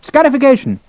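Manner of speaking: high-pitched colonel's bark. A narrator's reading. Colonel to Captain Hogan, barked. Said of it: "Sir Henry barked to Hogan".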